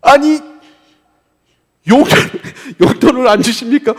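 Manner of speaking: high, loud playful tantrum with a laugh; mock irritated snapping. Child demanding pocket money from parents, mock irritated. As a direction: pretend to throw a fit, breaking into laughter. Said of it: "엄마 아빠한테 막 신경질 내면서".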